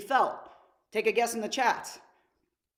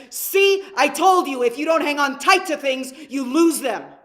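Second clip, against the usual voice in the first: loud, furious scolding shout, much louder, See shot up high; angry dad; 3 semitones higher, 8 dB louder than usual. Retold or imitated. Dad scolding his son for losing the balloon, angry loud shout. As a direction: bark it at the child like an enraged father. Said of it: "in a state of anger".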